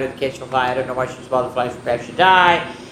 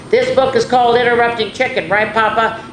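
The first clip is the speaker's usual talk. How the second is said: eager chicken voice; 8 semitones higher, 5 dB louder than usual. Acted. Reading the chicken's lines aloud.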